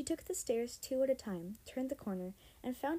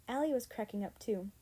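plain reading voice

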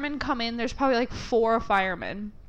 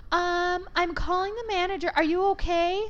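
put-on high, concerned girl's voice; imitated salon employee; 8 semitones higher than usual. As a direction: pitch it up, checking through a door. Voicing salon girl calling through door.

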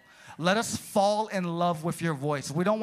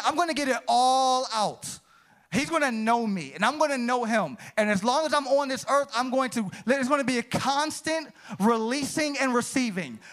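preaching; 6 semitones higher than usual. Acted. To the church, preaching at full force. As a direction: preach it with rising fire.

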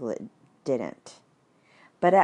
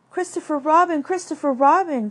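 high, loud desperate call; crying out; 11 semitones higher, 7 dB louder than usual. Acted. Piglet begging Christopher Robin for help. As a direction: cry out for rescue. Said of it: "cried Piglet".

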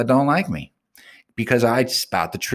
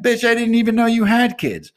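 higher, louder outraged comeback; indignant retort; 6 dB louder than usual. Retold or imitated. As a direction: fire it back at her in disbelief. Acted quarrel, retort to accuser.

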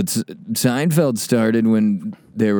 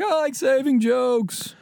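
high, slow Seinfeld quote; 13 semitones higher, 3 dB quieter, slower than usual. Retold or imitated. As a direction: drawl it out like Jerry. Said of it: "And he's like".

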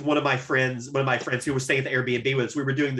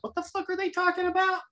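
high-pitched, softly squeaked bewilderment; mock baffled listener; 5 dB quieter than usual. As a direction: imitate a confused listener, pitched way up. Voicing baffled future listeners of show.

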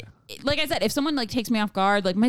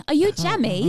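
put-on higher-pitched British accent; 7 semitones higher, 3 dB louder than usual. Co-host turning a friend's odd question British, in a British accent. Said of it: "Sounds like Brit".

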